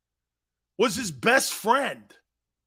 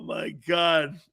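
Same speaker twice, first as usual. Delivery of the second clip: normal talking voice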